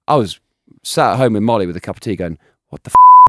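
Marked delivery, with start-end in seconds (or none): 2.7-3.3 high, loud disbelieving exclamation; stunned at his own antics; shocked outburst. Retold or imitated. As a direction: cry out in disbelief, cut off. Shocked watching highlights.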